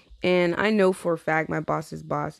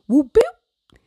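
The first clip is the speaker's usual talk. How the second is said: high whispered boop sounds; 10 semitones higher, 8 dB louder than usual. Retold or imitated. Imitated button noises.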